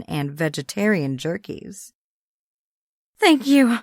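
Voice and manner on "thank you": put-on grateful young woman. Abigail thanks Merle.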